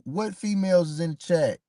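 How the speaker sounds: ordinary talk, nothing marked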